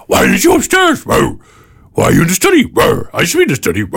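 high, shouty indignant dog; dog's voice. Retold or imitated.

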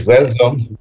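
ordinary talk

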